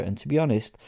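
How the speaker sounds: ordinary delivery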